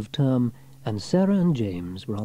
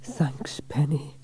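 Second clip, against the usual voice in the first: soft whispered thanks; 5 semitones higher, 3 dB quieter, much slower than usual. A narrator's reading. James thanks Penny after the rescue, whispering. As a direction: hush it gratefully. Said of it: "said James".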